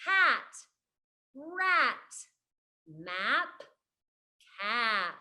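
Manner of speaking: one word at a time with long pauses; spaced-out, carefully sounded word list; example words. Shown as a demonstration. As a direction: set each word apart for young learners. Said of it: "words like".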